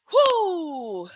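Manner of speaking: high, long "whoo"; high celebratory whoop; excited whoop. Real, not acted. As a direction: let out a big breath of excitement. Host lets out her excitement.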